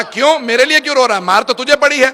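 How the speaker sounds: loud high shout; booming caliph demanding why; loud demanding question. Acted. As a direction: fire the question back at full force. Caliph questions Bahlul, sermon climax.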